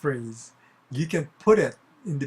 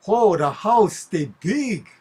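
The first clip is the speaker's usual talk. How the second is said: loud, higher Hawaiian pidgin exclamation; 4 semitones higher, 6 dB louder, slower than usual. Shown as a demonstration. Local remarking on a huge mansion, Hawaiian pidgin. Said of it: "In the pidgin English in Hawaii".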